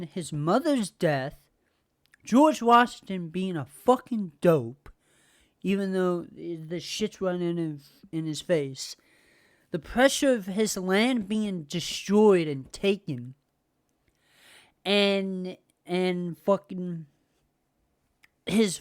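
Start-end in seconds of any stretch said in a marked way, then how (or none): none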